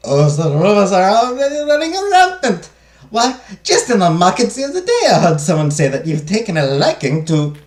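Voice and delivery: loud, high, gossiping maid in bad French accent; breathless gossip in a French accent gone German; attempted French accent. Maid spills market rumors to madame, attempted French accent. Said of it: "I'm trying for a French accent".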